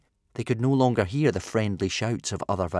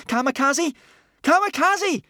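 high, loud calls, second higher; shrieked call; 6 dB louder than usual. A narrator's reading. Boy calling vanished friend, shrieking. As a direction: scream the name, desperate for an answer. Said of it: "shrieked Hiccup".

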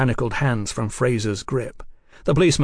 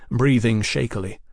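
usual speaking voice

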